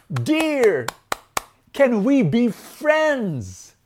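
high, loud, very drawn-out appeal; elephant's plea. Acted. Elephant asks the deer for friendship, high pleading call. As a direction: hail them hopefully, stretching the last word. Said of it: "And so the elephant says".

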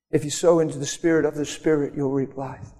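normal talking voice

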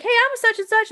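high, chirpy eager attendee voice; mock eager attendee. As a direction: pipe up high like an eager networker.

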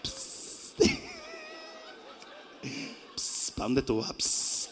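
sly, hushed catcall of a street flirt; street catcaller. Acted. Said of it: "los tigres de la calle".

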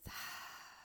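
long soft breathy sigh; gentle releasing exhale; exhale through mouth. Shown as a demonstration. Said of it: "out through the mouth".

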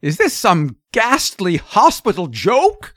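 incredulous, indignant father; rising rage. A narrator's reading. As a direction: demand it in horrified anger. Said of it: "his terror resolving into rage".

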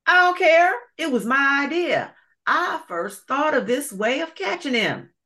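put-on high, loud childish insisting; petulant alligator. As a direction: whine like a spoiled gator.

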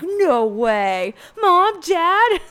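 loud, pitch leaping high in disbelief; hammed-up delighted astonishment; mock surprise. Vlogger mimicking Peter when his family shows up, mock surprised.

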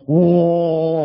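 mock alarm at a near miss; alarmed whoa. Driver retelling a near miss with a bus, alarmed whoa. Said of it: "like that".

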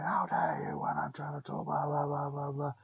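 hushed, unvoiced retelling of a rant; whispered mock scream. Teacher screaming at a pupil, retold as a whisper. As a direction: mimic his screaming in a whisper. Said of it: "hear him screaming at this guy".

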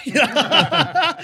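high burst of real laughter through the words; laughing. Real, not acted. Laughing while telling his mate the story.

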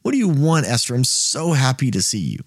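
mock the doting king begging her to take it; lovestruck king. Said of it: "He's like".

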